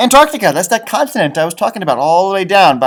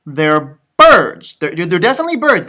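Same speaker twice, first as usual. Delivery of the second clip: ordinary delivery, nothing marked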